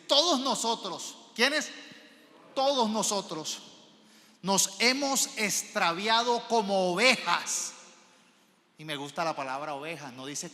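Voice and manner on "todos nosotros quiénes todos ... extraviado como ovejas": loud, high scripture proclamation; emphatic proclamation. Real, not acted. Preacher proclaims Isaiah to the congregation, emphatic proclamation. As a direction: preach the verse out forcefully, slowing at the end.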